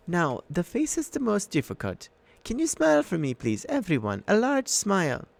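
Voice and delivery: high, sugary photographer coaxing; sweet monster in disguise, coaxing; sugary old lady. A narrator's reading. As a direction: lure them into smiling. Auntie Em coaxes smiles.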